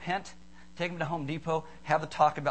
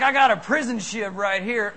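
pretend tough kid; 4 semitones higher, 7 dB louder than usual. Acting out kid shanking with chip.

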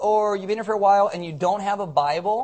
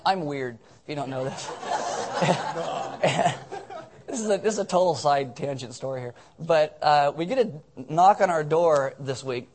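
normal talking voice

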